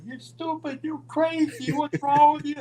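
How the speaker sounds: high-pitched, exasperated rant; imitated yelling. Ole out of the car yelling at Roddy, angry. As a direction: rail at him in a very high voice. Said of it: "he's yelling at Roddy".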